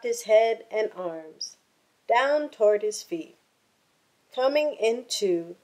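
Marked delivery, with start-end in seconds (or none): none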